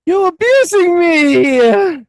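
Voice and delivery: loud, very high, drawn-out wail of protest; mock wail. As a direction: wail it like a hammed-up victim, stretching the words. Acting out the son's protest.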